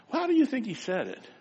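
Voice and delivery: ordinary delivery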